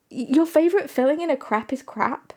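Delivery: normal talking voice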